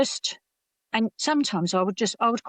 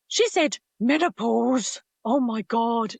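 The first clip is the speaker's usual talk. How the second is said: higher-pitched, drawn-out imitation of scandalised colleagues; mock horror; 6 semitones higher, 3 dB louder, slower than usual. Men in a meeting hear menopause mentioned, mock horror. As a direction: act out horrified disbelief, drawing it out. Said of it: "they were horrified".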